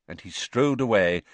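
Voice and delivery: ordinary delivery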